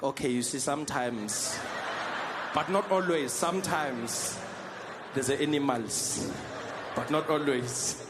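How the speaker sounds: slow, drawn-out hedging; a sheepish South African caught out; sheepish backpedal. Retold or imitated. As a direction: stretch each word and hedge. Sheepish backtracking to Americans.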